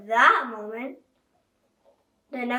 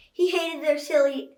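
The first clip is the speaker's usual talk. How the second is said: plain reading voice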